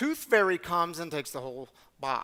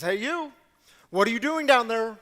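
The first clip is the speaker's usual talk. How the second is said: retold police officer calling down from the bridge, raised and loud; calling down shout; 5 semitones higher, 7 dB louder than usual. Police officer on bridge calls down to hiding rabbi, loud call. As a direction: call out to someone below the bridge. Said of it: "and he shouts down".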